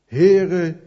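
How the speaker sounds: a loud, urgent call of Heere; cry for help. Acted. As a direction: cry out as one sinking in the waves. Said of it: "riep hij". (luid, dringend uitgeroepen)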